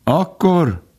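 high, proud opening word; boastful. A narrator's reading. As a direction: announce it with pride. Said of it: "Gobó büszkélkedve mondta".

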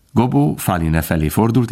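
usual speaking voice

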